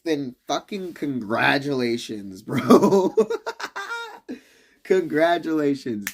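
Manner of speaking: loud, laughing mock congratulations; sarcastic congratulations. Real, not acted. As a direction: congratulate them with a sneer and a laugh.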